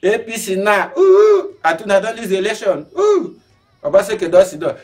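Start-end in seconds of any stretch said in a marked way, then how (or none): 1.0-1.6 high, loud ooh; surprised ooh. Real, not acted. Host reacting to election loss, high surprised ooh. 3.0-3.4 surprised ooh. Real, not acted. Exclaiming again.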